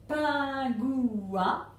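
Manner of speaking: PINGOUIN loudly stretched into pin... gou... in; three syllables. Shown as a demonstration. Teacher counting syllables for pupils, PINGOUIN as pin-gou-in, drawn out. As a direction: draw PINGOUIN out over three long beats. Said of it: "trois syllabes dans pingouin".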